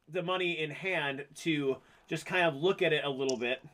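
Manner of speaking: ordinary delivery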